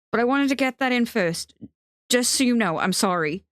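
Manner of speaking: anxious to get the apology out; pleading apology. Acted. Theo apologising at the end of Benji's knife, pleading.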